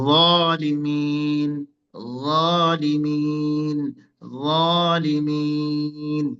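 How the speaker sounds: যালিমিন drawn out very slowly; slow recitation. Shown as a demonstration. Teacher reciting যালিমিন slowly for students. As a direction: recite যালিমিন long and measured. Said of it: "এভাবে উচ্চারণ করতে হবে".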